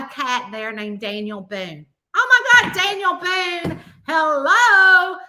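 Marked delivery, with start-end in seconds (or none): 2.1-5.2 high, loud delighted outburst; gushing, emphatic amazement; excited exclamation. Retold or imitated. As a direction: exclaim it like spotting a celebrity. Acting out delight at cat's name.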